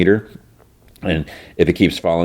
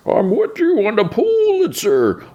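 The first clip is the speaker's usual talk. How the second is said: high, slow put-on voice for the big guy; a mild, reasonable Bane done way up high; silly high-pitched imitation of Bane. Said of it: "And Bain's, like, sitting there".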